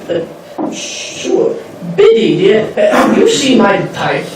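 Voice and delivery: pompous aristocrat, swooping pitch, drawn-out key words; Benjamin Wickerdent. Acted. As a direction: play a haughty, grandiose lord who relishes ceremony. Plays pompous gentleman from eighteen hundreds.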